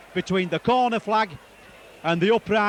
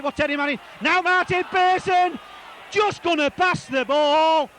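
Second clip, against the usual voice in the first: excited play-by-play; 9 semitones higher than usual. Real, not acted. Commentator following Terry Manning's run, excited. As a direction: chase the ball along the line. Live call of an attacking run.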